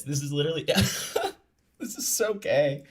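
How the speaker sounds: laughing high and breathy at it; mocking laugh. Real, not acted. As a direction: scoff through a laugh, pitch jumping up. Mocking the quoted apology.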